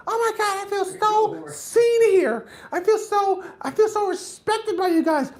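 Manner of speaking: very high, slow, gushing mock girl voice. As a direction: gush like you finally feel respected.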